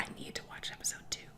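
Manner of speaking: desperate unvoiced whisper; rushed, hushed like an addict. Whispering her craving for episode two to viewers.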